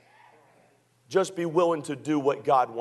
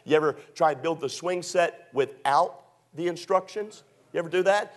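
ordinary talk

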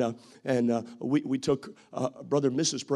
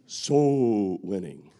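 "Soul winning" much lower, very slow and eerie; spooky Halloween voice; 7 semitones lower, much slower than usual. Pretend. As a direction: drag it out like a haunted-house voice. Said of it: "something that you practice on Halloween".

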